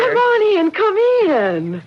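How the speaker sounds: high, bright rush to invite her in; excited welcome; eager welcome. Acted. Laura welcomes Min inside, high and eager.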